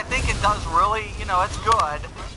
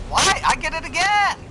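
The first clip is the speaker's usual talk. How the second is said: surprised outcry; 9 semitones higher, 4 dB louder than usual. Real, not acted. Getting hit again in game.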